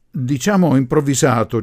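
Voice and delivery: ordinary delivery, nothing marked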